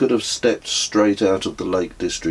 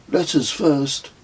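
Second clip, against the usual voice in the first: firm; 8 semitones higher, slower than usual. Acted. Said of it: "he stated firmly".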